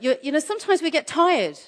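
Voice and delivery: ordinary delivery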